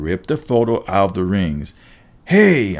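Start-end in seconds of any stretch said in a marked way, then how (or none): 2.2-2.8 sharp higher, louder cry of protest. A narrator's reading. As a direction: call out "hey" in objection. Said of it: "I protested".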